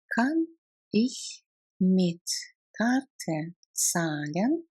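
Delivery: slow, separated words. Shown as a demonstration. Teacher shows learners how to ask to pay, word by word. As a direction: spell out the question one word at a time. Language drill, broken into words.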